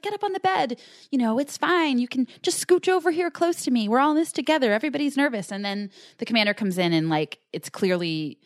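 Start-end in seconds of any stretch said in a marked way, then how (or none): 0.0-5.5 high-pitched, coaxing, overly nice wife. Retold or imitated. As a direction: sweet-talk them in a mock overly nice voice, pitched high. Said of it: "she's this overly nice".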